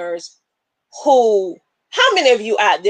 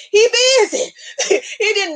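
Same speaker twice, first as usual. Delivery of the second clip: laughing; 12 semitones higher than usual. Real, not acted. Laughing about the devil.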